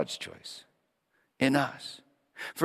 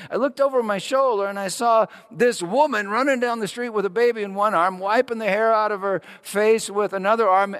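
a hint of an eager milkman, higher; lightly voiced milkman; 11 semitones higher, 8 dB louder than usual. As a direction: tell it like a breathless, excited milkman. Milkman Ben voiced in story.

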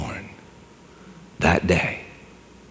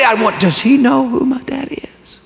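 whisper; 11 semitones higher than usual. Acted. Boy's frightened inner thought.

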